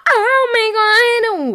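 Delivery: exasperated outburst. Real, not acted. Frustrated at stumbling over words.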